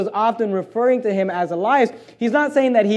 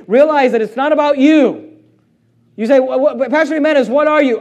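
loud, high-pitched revival preaching; full-force preaching; 5 semitones higher, 5 dB louder than usual. Acted. Pastor exhorting young men going into ministry, preaching hard. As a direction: drive it home to the future ministers.

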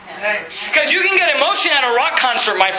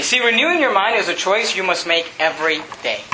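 ordinary talk

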